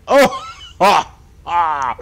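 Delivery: short loud cries of fright; sharp high gasp-shouts; startled yelps. Real, not acted. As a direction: yelp as if jumped at. Startled by game thunder.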